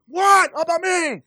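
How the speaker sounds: sudden high, loud what; high startled protest; indignant surprise. Retold or imitated. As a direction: caught off guard and left out. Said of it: "something inside me is like".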